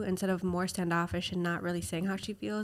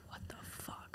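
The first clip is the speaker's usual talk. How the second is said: a shocked whisper of disbelief; stunned whisper; 18 dB quieter, slower than usual. Retold or imitated. Recalling the daughter's accusation, a stunned whisper. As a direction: breathe it out in disbelief, barely voiced.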